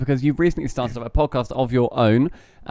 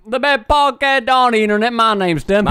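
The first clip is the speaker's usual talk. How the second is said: put-on Texan accent; 6 semitones higher than usual. Podcast host greets listeners in a high mock-Texan accent. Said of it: "in Texas with that accent".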